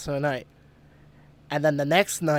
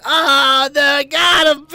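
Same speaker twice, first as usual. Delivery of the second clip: high, loud cry of comic alarm; mock panicked scream; 8 semitones higher, 7 dB louder, slower than usual. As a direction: wail it in hammed-up terror. Acting out panic at friend's screams.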